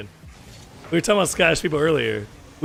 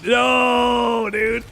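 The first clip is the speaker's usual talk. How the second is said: frustrated shout; 5 semitones higher than usual. Real, not acted. Arguing with chat.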